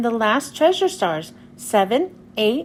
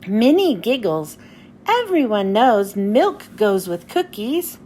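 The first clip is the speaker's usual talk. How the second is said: usual speaking voice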